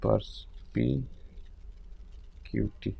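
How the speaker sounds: perspicacity breathed out slowly, letter by letter; whispered spelling out. Shown as a demonstration. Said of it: "whispered slow while writing".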